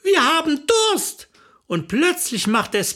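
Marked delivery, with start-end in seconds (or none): 0.0-1.3 high, slow demanding cry; acted pleading holler; impatient call. A narrator's reading. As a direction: draw it out in a raised voice. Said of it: "rief Renate".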